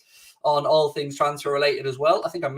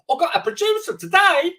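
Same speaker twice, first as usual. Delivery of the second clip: much higher, hammed-up odd accent; Johnny Big Ball accent; 14 semitones higher, 4 dB louder than usual. Boasting to the chat about his new producer, in a silly put-on accent. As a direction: lord it up as the big man with a producer. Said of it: "I don't know what that accent was".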